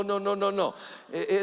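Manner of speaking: ordinary talk, nothing marked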